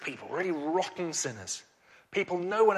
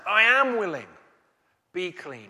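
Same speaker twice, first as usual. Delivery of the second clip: Jesus answering; 2 semitones higher, 9 dB louder than usual. Acted. Voicing Jesus' reply.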